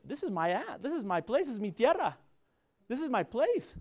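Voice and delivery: acted-out protest of belonging; proud insistence. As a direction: stake your claim, rising on each phrase. Acting out his sense of belonging.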